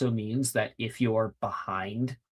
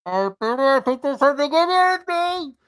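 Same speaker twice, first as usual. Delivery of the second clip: high, loud racer shout; character yell; 9 dB louder than usual. Acted. Racer calls out mid-race, high loud yell. Game master voices racing character.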